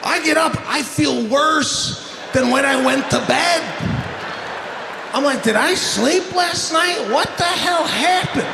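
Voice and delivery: full-volume ranting delivery; loud comic rant. Real, not acted. Comic rants to the crowd about bad sleep, loud. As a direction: blast it at the crowd.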